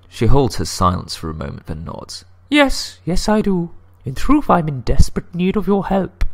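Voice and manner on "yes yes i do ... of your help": high-pitched put-on lady's voice; high falsetto woman. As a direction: play the lady client, pitched way up. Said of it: "I get to do the lady voice".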